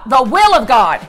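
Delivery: ordinary talk, nothing marked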